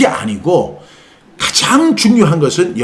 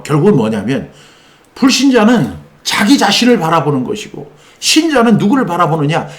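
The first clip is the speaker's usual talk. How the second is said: normal talking voice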